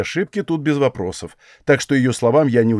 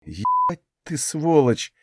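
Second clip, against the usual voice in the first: slow, higher stunned curse; flabbergasted; 5 semitones higher, 3 dB quieter, much slower than usual. A narrator's reading. Hero stunned by her nerve, flabbergasted. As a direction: drag out the curse in disbelief. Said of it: "офигел я от такой наглости".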